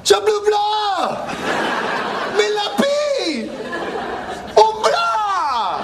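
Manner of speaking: frantic fake French.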